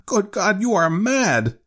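high-pitched horrified outcry; aghast. A narrator's reading. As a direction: exclaim aghast at your wife's madness. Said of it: "he cried aghast".

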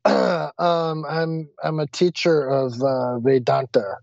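halting, sheepish admission; retold reluctant confession, full of ums; embarrassed. Teacher admitting the job to the scolding driver, sheepish. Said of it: "So embarrassed now".

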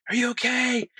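high-pitched calling out for a friend; retold yelling. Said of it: "I'm, like, yelling for Scott".

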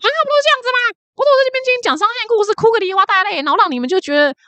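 very high mock scolding, fast at the start; scolding sister. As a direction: rant like the furious older sister, pitch way up. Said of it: "金三顺他姐骂他那一顿".

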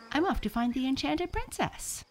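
high-pitched heroic exclamation; excited cry. A narrator's reading. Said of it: "cried Max".

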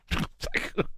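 soft breathy chuckle; low-volume giggle; laughing. Real, not acted. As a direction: crack up quietly at your own impression. Laughs at own accent imitation.

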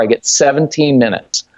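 ordinary delivery

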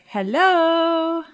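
loud high call through a door; called out hello. Acted. Player calling hello at the barred door, loud. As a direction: call out to whoever is inside. Lynette calls at shut door.

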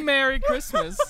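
high, loud laughing cheer; cracking up while reading aloud. Real, not acted. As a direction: squeal it through helpless laughter. Laughing at upside-down gift.

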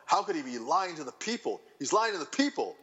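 high-pitched exasperated outburst, retold; mock your own indignant protest, pitched well up; aggravated. Retelling his reaction to a suspected liar, aggravated. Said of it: "so aggravated".

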